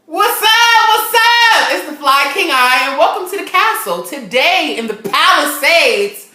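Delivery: loud, high-pitched hype greeting; show host intro. Real, not acted. Host welcomes viewers to the castle, hyped announcer intro. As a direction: belt out a big, stretched-out show opening.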